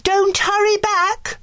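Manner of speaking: very high, slow fairy call. A narrator's reading. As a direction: sing out after her sweetly. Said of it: "called Merryweather".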